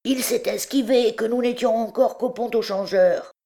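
high character voice; high-pitched henchman passing on news; reporting underling. A narrator's reading. Same underling reports to chief.

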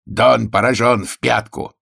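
hoarse, drawn-out verdict from an unseen attacker; hoarse inhuman voice. A narrator's reading. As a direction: proclaim it as an inhuman herald. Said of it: "Хриплый нечеловеческий голос сообщил".

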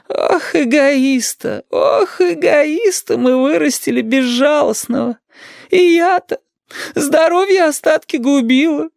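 very high, slow, wailing granny; high-pitched tearful old woman's reproach; reproachful grandmother. A narrator's reading. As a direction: lament and scold as a martyred old grandmother, drawing words out.

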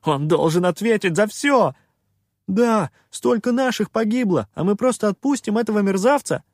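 high, loud, outraged cries; losing self-control. A narrator's reading. Villagers demand the pirate answer for deaths, outraged. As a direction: let the anger burst out. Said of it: "потеряв самообладание".

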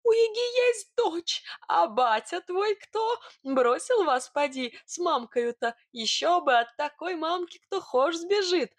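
high, squeaky, gleeful rumour-monger; gossiping Sidor. Acted. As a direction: spin the scandal in a high piping voice. Sidor gleefully spinning a scandal.

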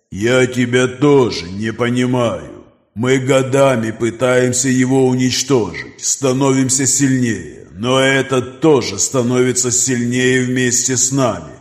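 slow, distant predatory creature; monster voice. Acted. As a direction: speak like a cold ancient beast. Creature Nokris speaks.

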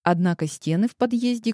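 plain reading voice

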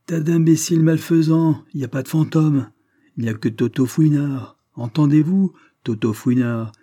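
high, loud jeering cry; mocking shout. A narrator's reading. Toto unmasks himself and mocks the guests, loud and jeering. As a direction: bellow it with cheeky scorn. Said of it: "une voix gouailleuse cria".

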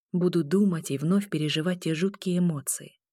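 ordinary delivery

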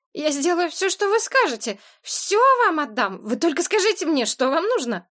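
high, frightened pleading babble; plaintive boy. A narrator's reading. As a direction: beg desperately in a thin pitiful voice. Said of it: "залепетал он прежним жалобным голосом". (жалобный умоляющий лепет)

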